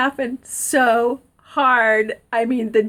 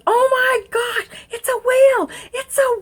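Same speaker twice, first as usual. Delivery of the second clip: excited discovery; 11 semitones higher than usual. Retold or imitated. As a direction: draw out the big find, voice way up. Sheriff thinks he found the whale.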